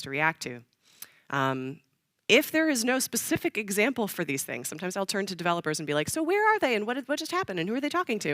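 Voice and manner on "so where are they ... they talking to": high, quick string of questions; acted questioning. Actor quizzing the game developers for context, high questioning. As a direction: pepper them with questions in a higher pitch.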